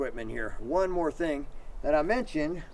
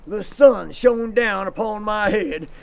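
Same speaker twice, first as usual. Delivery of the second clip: ordinary delivery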